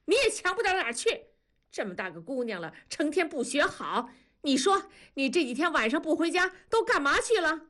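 mother turns on her daughter, furious and fast; shouting at daughter. A narrator's reading. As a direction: turn and snap at the daughter, high and quick. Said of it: "冲着多多喊".

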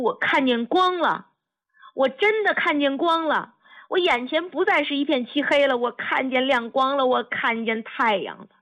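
high-pitched, overwhelmed exclamation; elated outpouring, pitched high and rushing on. A narrator's reading. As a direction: cry it out in mounting disbelief and joy. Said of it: "猛地就提高了声音， 激动得浑身发抖".

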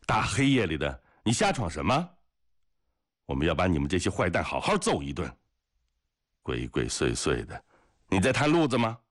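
high, hectoring yell at a stranger's back; rough scolding shout; yelling after him. A narrator's reading. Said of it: "哥萨克在他身后嚷嚷着".

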